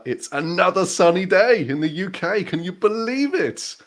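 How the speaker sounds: bright and high, marvelling at the sun; excited host. Real, not acted. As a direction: sell the sunny day with delight. Cheerful live show opening.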